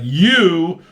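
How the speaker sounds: loud, high, accusing outburst; imitating an outraged accusation; mock yell. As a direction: yell it like a betrayed friend, hammed up. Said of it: "yelling at Magneto".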